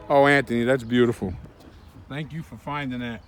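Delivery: tender. Real, not acted. Tender thanks to Anthony.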